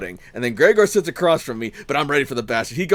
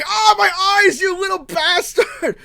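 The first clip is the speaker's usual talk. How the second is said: mock scream; 12 semitones higher than usual. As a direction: wail with stinging eyes. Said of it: "he's, like, screaming".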